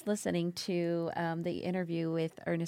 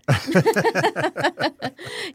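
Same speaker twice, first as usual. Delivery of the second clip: a big, high burst of laughter; bright delighted laughing burst; loud laugh; 10 semitones higher, 11 dB louder than usual. Real, not acted. Hosts cheer guest's remark.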